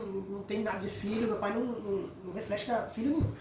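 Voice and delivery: normal talking voice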